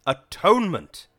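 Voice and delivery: huge slow bellow, high and very loud; one-word radio bellow from the preacher. Acted. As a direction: boom it out over the radio like a revival preacher. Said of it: "this voice bellowed".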